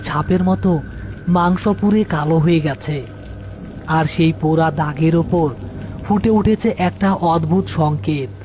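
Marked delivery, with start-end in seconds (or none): none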